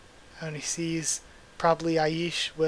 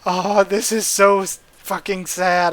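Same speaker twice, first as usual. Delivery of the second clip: upset outburst; 2 semitones higher, 7 dB louder than usual. Real, not acted. Reader upset at a death.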